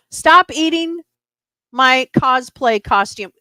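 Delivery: high, loud telling-off; real scolding. Real, not acted. Owner to cat eating cosplay costume, sharp loud scolding. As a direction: order the cat off, loud and emphatic.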